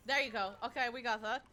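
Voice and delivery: loud relieved cheer; loud happy relief. Real, not acted. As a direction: celebrate the hit loudly. Hit lands, relief.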